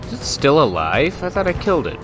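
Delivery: ordinary talk, nothing marked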